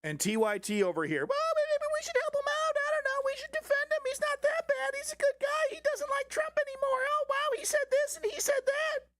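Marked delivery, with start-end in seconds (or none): 1.2-9.1 squeaky falsetto parody, way up high; mock them in a tiny high squeal; cartoonish high-pitched caricature. Mocking TYT hosts again, same squeak.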